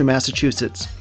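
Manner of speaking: ordinary talk, nothing marked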